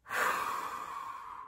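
unvoiced whoosh of air; long blowing breath. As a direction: blow it out like wind. Wolf blows on the house.

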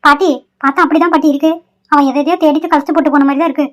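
ordinary talk, nothing marked

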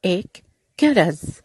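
إك كرز said slowly, each part drawn out; slow sounding out. Shown as a demonstration. Teacher sounding out إك كرز slowly for children. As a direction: stretch إك then كرز slowly. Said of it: "صوتي إك مع كلمة كرز".

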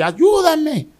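high-pitched mock plea of Ayúdame; recreating the moment his throat came free; retold cry for help. Retelling his deliverance, a high mock cry for help. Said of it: "yo voceé".

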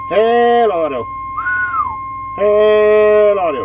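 loud mic test. Real, not acted. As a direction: belt hello into the mic to peak the meter. Testing the radio mic.

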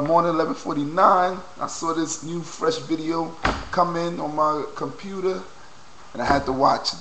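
ordinary delivery

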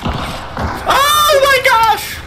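drawn-out high cry of disbelief; shocked shout. Real, not acted. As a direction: stretch it out in shock. Teammate killed by a grunt.

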